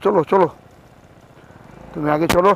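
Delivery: loud call to a friend ahead. Real, not acted. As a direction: call out across the trail.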